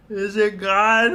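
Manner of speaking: high, slow, cautious question; timid fear. Retold or imitated. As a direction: ask it slowly in a small high voice. Acted timid question after hiding.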